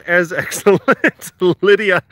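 ordinary talk

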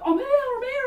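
high, loud, laughing "I'm here"; eager spirit calling out. Retold or imitated. Medium voicing the eager spirit, a high loud laughing call. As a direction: play the spirit bursting in, eager to be noticed. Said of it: "and like, John's like".

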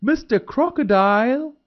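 high, loud rising call across the river; shouted call. A narrator's reading. Deer on the riverbank calling the crocodile, high loud shout.

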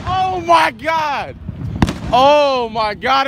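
slow, high drawn-out oh my God; stunned exclamation. Real, not acted. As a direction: stretch it out in disbelief. Reacting to the blast.

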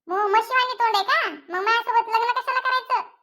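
a tart wife putting her husband in his place; mother's voice. Acted. Mother retorts to insult.

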